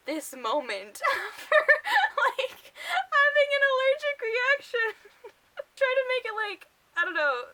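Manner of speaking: high, loud giggling through the words; real laughter breaking the words; laughing while talking. Real, not acted. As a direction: dissolve into laughter as you speak. Laughing at absurd scene.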